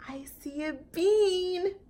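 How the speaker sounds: slow, high delighted voice; delighted bear. Acted. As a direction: savor the discovery. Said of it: "said Corduroy".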